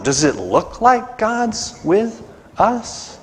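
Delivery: weary plea. Acted. Gideon asking the angel, slow weary despair. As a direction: drag it out, worn down. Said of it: "boldly looks at this angel and says".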